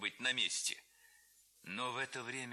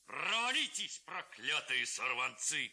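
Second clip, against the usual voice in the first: put-on higher, louder furious old man; angry shout; 4 semitones higher, 3 dB louder, slower than usual. A narrator's reading. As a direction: drive the boys off, yelling. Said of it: "кричал голова". (голова сердито кричит на хлопцев)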